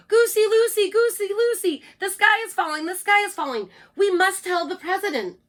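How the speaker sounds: high, loud frantic yell; panicky yell. Acted. Birds warn Goosey Lucy, high panicky yell. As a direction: shout the alarm like a frightened bird.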